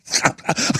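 a short, airless laugh of disbelief; breathy disbelieving laugh. Real, not acted. Host laughing in disbelief at the news, a short breathy laugh. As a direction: let out a quiet exasperated laugh. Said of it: "I'm laughing 'cause I'm going crazy here".

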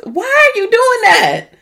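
high, loud, scolding disbelief; incredulous scolding. Real, not acted. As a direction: demand an answer in disbelief. Scolding the guy on the show.

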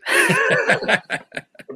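a quick high laugh at himself; short laugh. Real, not acted. Host laughing after fumbling his own age, amused. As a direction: laugh off the slip.

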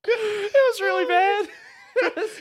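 very high, squeaky words through laughter; helpless real laughter. Real, not acted. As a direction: squeeze the words out while cracking up. Helpless laughter while recalling.

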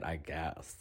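trailing off, mock-unimpressed; grudging clerk. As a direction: imitate a clerk grudgingly conceding. Clerk's grudging answer, retold.

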